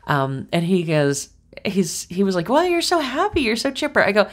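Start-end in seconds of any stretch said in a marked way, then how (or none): 2.5-4.0 high, sing-song imitation of the tow truck guy; playful high-pitched impression; mock tow truck guy. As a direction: mimic the cheery roadside guy, pitched way up. Tow truck guy quoted in story.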